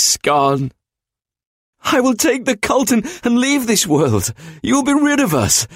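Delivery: high pleading trapped demon; frightened demon brother. Acted. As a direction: beg him not to leave you in the dark. Trapped brother begs in void.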